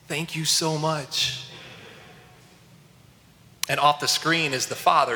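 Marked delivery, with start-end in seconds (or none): 0.1-1.4 slow, understated imitation of a tearful boy; retold tearful thanks. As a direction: draw the thanks out slowly, lightly imitating a crying child. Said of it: "barely hear him make out the words as he's bawling".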